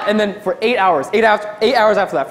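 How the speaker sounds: ordinary delivery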